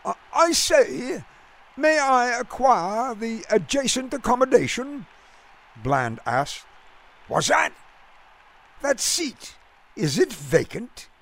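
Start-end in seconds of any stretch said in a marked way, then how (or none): 0.1-5.1 high-voiced English gent, lofty accent; over-polite request in a lofty British accent; lofty, clipped British accent. A narrator's reading. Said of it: "in his lofty, clipped accent". 7.3-7.7 lightly voiced Brooklyn fan, pitched up; puzzled ballpark fan. A narrator's reading. Gruff fan answers puzzling stranger. 8.8-11.1 put-on high English gent's clipped accent; lofty British accent. A narrator's reading. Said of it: "in his lofty, clipped accent".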